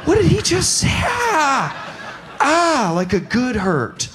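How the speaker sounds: high, laughing squeals of delight; giddy delight. Retold or imitated. Comedian reacting to the southern pilot's line with squealing delight. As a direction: squeal like it hurts so good.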